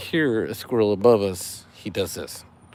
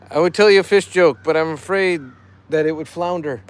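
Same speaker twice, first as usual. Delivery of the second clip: higher, louder corny fish puns; dad joke voice; 5 semitones higher, 5 dB louder than usual. Acted. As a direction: ham up the pun like a dad. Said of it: "I'm doing it in dad joke voice".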